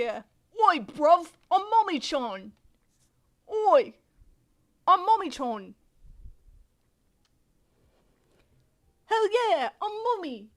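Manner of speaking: excited, high put-on British voice; British accent. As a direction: cheer it out in a playful British accent. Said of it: "I can't do my British accent".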